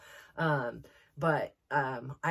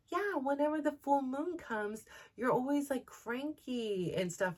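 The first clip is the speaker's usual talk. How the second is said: put-on high matter-of-fact child; little boy voice; 9 semitones higher than usual. Son telling mom she's cranky at full moon, high childlike voice. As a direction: say it like a frank little kid. Son explains, quoted by mother.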